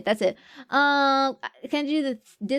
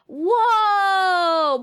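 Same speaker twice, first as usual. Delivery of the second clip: loud, high mock gasp of shock; whoa; 7 semitones higher, 5 dB louder than usual. Real, not acted. Streamer gasping at a chat donation confession, loud and high. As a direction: act floored by the news. Reacting to a viewer's big spending.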